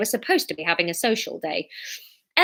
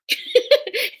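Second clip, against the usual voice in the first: short high laugh; 14 semitones higher than usual. Real, not acted. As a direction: break into a laugh. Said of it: "laughs at accent".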